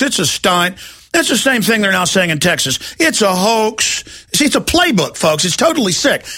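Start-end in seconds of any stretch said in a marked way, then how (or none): 3.0-4.3 slow mocking drawl. Repeating the officials' line slowly.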